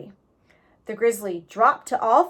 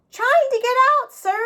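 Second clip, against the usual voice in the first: put-on higher boy voice; boy's voice; 6 dB louder than usual. A narrator's reading. Jack answering the stranger from the hole, higher boy voice. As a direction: play young Jack, polite.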